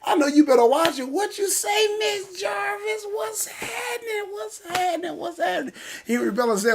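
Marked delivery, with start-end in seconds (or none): 1.1-5.7 high, squealing greeting to a friend; excited squeal greeting. Real, not acted. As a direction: greet her like you haven't seen her in ages.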